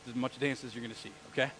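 ordinary delivery

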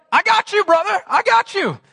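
reassuring friend. Retold or imitated. Jesus comforting his best friend, reassuring. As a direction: clap him on the back with it.

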